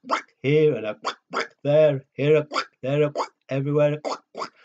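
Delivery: loud duck quacks sung fast; quacking duck. Acted. Sung duck quacks for the child on the knee. Duck noises in farm song.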